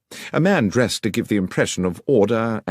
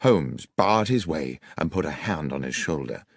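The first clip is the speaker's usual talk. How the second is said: ordinary delivery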